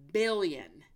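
usual speaking voice

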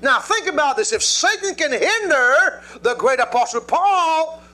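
high, loud preaching, slow on the scripture; full-force preaching. Acted. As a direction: preach it out to the congregation. Preaching to the congregation.

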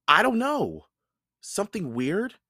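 put-on a puzzled boy in the dark; young Jack. A narrator's reading. Narrator voices Jack.

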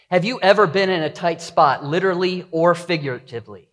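usual speaking voice

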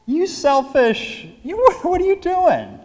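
high, hammed-up outrage; indignant scolding. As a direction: scold in mock disbelief, sputtering at first. Mock scolding of the apostles.

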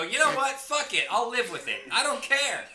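fed-up, hammed-up defiance; exasperated outburst. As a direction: throw your hands up and yell it. Acts out giving up in exasperation.

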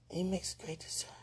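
soft unvoiced whisper. Real, not acted. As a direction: confide it in a whisper. Whispering about him.

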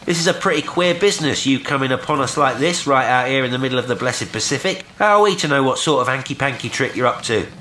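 brisk challenge. A narrator's reading. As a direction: rattle the questions off across the water. Will challenges stranger across water.

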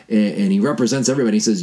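usual speaking voice

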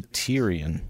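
Tyrion begun like "tier"; TEER-ee-un. Shown as a demonstration. Host questions the show's pronunciation, Tyrion said TEER-ee-un. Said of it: "Why wouldn't it just be".

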